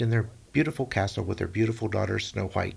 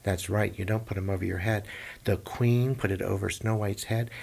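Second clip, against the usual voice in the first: plain reading voice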